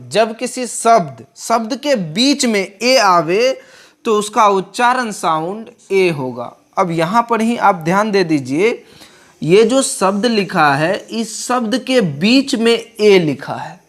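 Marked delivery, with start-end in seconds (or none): none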